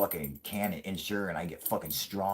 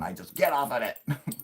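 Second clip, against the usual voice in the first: usual speaking voice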